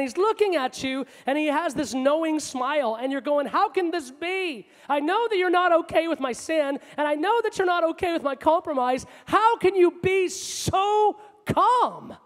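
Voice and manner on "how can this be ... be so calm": incredulous protest. Acted. Preacher voices sinner questioning a calm God, incredulous. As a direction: build to a pleading, stretched-out outburst.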